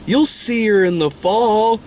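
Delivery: high, slow imitated boy; drawn-out high kid voice; kid friend impression. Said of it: "his fucking friend is like".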